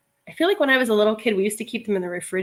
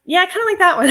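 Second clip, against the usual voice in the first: mock agreeing with husband; 9 semitones higher, 8 dB louder, faster than usual. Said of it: "I'll be like".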